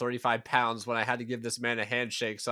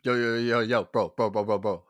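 loud, repeated bro-speak impression; mock urgent bro chatter; imitating ghost hunters; 8 dB louder than usual. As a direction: fire off insistent bursts like an alarmed dude. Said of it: "It's more like".

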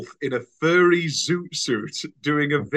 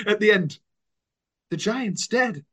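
ordinary talk

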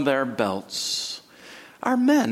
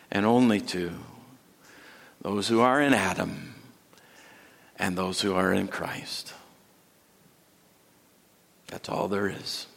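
soft solemn emphasis; 12 semitones lower, 6 dB quieter than usual. Acted. Sermon drops to soft emphasis.

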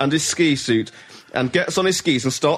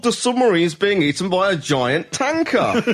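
mock shock; 7 semitones higher than usual. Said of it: "looks through it and goes".

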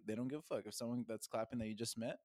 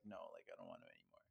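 much lower, rushed and quieter; deep fast mock voice; 17 dB quieter, faster than usual. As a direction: drop the voice way down and rush it. Acting out the partner's refusal.

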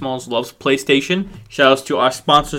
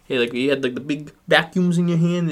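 normal talking voice

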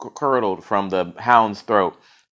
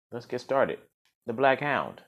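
ordinary delivery, nothing marked

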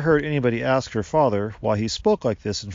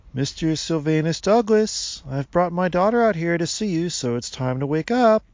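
raised, higher-pitched summons; calling out; 7 semitones higher, 4 dB louder than usual. A narrator's reading. Father rousing the man in the tree, calling out. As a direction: call up to wake a sleeper.

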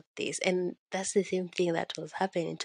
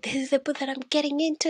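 retold eager announcement; 7 semitones higher, faster than usual. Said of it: "I had said".

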